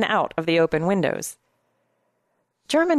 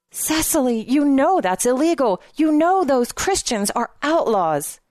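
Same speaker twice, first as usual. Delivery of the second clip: high, urgent reproach; alarmed protest; 6 semitones higher, 3 dB louder than usual. A narrator's reading. He warns her it is illegal, alarmed protest. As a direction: press the warning hard, pitch raised.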